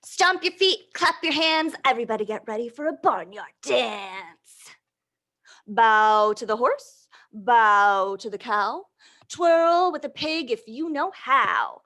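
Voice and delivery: put-on lively read-aloud to little kids; reading to children. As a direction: read the rhyme with bounce for the children. Reading picture book aloud.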